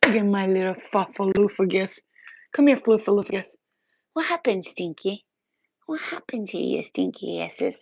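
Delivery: dote on the fluffy cat. Real, not acted. Calling the cats over, in doting baby talk.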